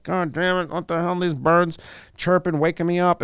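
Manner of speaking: mock irritated grumble. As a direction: play the grumpy sleeper cursing the birds. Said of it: "don't get pissed because you're like".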